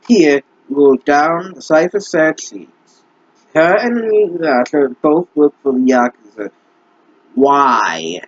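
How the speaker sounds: usual speaking voice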